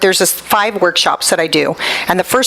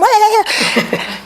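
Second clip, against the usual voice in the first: laugh; 13 semitones higher than usual. Real, not acted. Laughs after quote.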